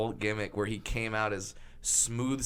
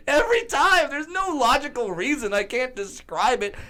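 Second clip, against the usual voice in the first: usual speaking voice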